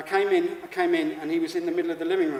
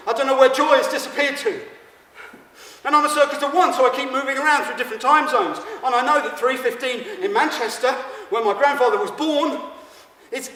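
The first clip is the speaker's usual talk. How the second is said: higher, louder frantic comic rant; manic rant; 7 semitones higher, 7 dB louder than usual. Acted. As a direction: spiral into mock panic about the time.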